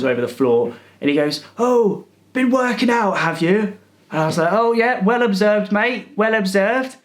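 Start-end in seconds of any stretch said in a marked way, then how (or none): 1.6-3.8 lightly voiced old man, pitched higher; a hint of a prying old cleaner; mock nosy question. 4.5-7.0 mock-bright sarcasm, higher, slowing at the end; sarcastic praise. Sarcastic reply to the cleaner.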